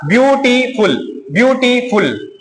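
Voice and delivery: "ब्यूटीफुल" slowly split into ब्यू-टी-फुल; syllable by syllable. Shown as a demonstration. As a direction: stretch "ब्यूटीफुल", pausing before the final फुल. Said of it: "दो तीन अक्षर इसमें सम्मिलित है". ("ब्यूटीफुल" को धीरे-धीरे अक्षरों में तोड़कर)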